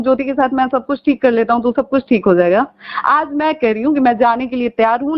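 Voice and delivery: ordinary talk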